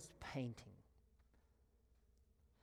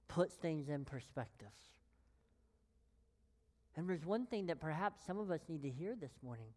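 ordinary talk

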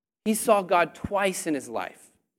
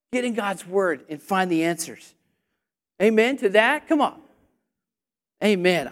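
ordinary talk

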